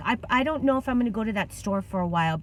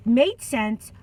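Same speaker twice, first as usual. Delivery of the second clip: normal talking voice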